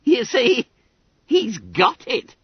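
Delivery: put-on high, squeaky boy full of giggles; gleeful Billy Bunter. A narrator's reading. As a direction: crow it in a high chirp. Bunter crows between giggles.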